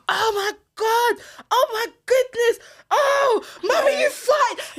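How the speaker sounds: put-on high, slow, overdramatic little girl; theatrical toddler voice. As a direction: ham it up like a child shocked. Daughter's theatrics.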